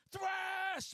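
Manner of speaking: high, loud metalhead yell; imitated shout. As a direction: yell it out like a fan at a show. Imitates people turning and yelling greeting.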